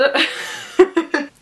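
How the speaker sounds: a burst of excited laughter. Real, not acted. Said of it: "I'm very excited".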